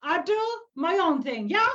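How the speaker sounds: high, emphatic elder voice; proud old druid. Acted. Old druid slaps her knee about going her own way, emphatic. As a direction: declare your independence in a high character voice.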